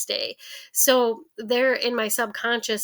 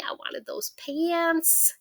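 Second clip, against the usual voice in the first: put-on higher, slow, whiny little girl; higher, slow sulky whine; whiny child; 7 semitones higher, much slower than usual. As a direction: drag it out like a sulking kid.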